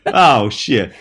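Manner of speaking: normal talking voice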